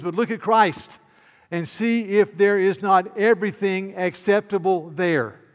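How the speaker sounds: loud, driving proclamation; emphatic capitals. Shown as a demonstration. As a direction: read the quote out as if in capitals. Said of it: "and I put the caps".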